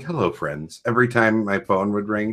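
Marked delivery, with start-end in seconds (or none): 0.0-0.8 a calm, soft hello like a ringtone; calm greeting. Retold or imitated. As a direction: lower and softer, calmly imitating Travis. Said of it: "just Travis calmly saying".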